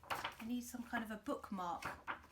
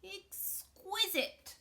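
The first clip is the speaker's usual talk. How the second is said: much higher, very slow crocodile; high slow snooty crocodile; 11 semitones higher, 5 dB louder, much slower than usual. Acted. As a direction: savour it like a gourmet. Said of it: "said the crocodile".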